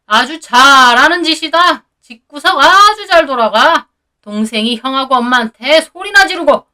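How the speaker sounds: seethe at the son with biting sarcasm; fuming father. A narrator's reading. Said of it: "씩씩거리더라고요". (씩씩거리며 비꼬는 시아버지)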